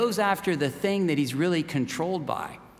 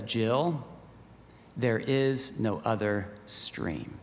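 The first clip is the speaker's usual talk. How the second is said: low, soft and slow, a solemn lion; deep, quiet lion voice closing the matter; grave lion; 7 semitones lower, 6 dB quieter, much slower than usual. Acted. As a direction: let it fall low and slow, final. Lion Aslan tells Jill.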